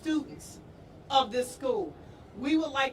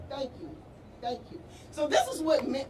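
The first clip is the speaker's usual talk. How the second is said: choked up; 2 semitones higher than usual. Real, not acted. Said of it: "I'm sorry, I get emotional".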